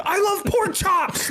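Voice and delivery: put-on very high, slow silly kid voice; mock kid shout. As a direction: stretch it out like a dumb kid yelling at the beach. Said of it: "saying the dumbest stuff".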